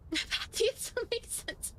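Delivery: high, breathless laugh through the words. Real, not acted. Player cracking up over the game dialogue, laughing. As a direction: squeeze the words out while really laughing. Breaks up laughing at own reading.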